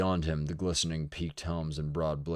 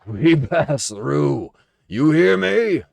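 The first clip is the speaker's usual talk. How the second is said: much higher, much louder, slow shout; taunting bellow; 10 semitones higher, 14 dB louder, much slower than usual. A narrator's reading. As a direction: roar it over the crowd. Bodden commands the mob.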